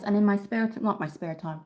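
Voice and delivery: ordinary talk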